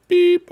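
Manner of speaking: high, loud beep noise; mock beep. Streamer imitating a game beep, high and loud. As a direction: beep like a machine.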